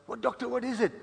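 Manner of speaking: 高く上ずった不安げな問いかけ; anxious plea. Acted. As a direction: pitch jumps up, anxious and pressing. Patient anxiously demanding bad news.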